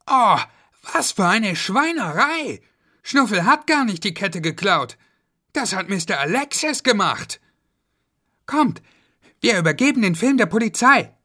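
put-on high indignant kid voice; a kid shocked at the villain; outraged child. Kids discover the real thief, high and outraged.

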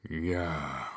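low, soft zombie reply; evil zombie. Acted. Zombie Schnuffel obeys the monster, low and soft. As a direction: answer like a hollow zombie. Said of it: "sagte der böse Schnuffel".

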